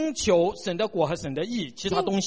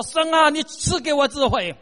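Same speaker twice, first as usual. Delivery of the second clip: calling out loudly to God, raised and higher; loud street prayer; 7 semitones higher, 6 dB louder than usual. Retold or imitated. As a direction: shout the prayer as if standing in the road. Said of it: "你站在馬路上大聲地說".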